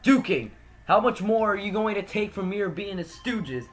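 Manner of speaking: loud, high, furious confrontation; angry shouting man. Acted. Angry friend berating Duking for serving criminals, furious shout. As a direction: yell at him as if he betrayed you.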